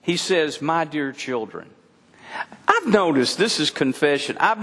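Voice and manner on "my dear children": put-on soft, lower, frail old man. Shown as a demonstration. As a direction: say it like a tender old grandpa. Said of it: "I start to talk like this".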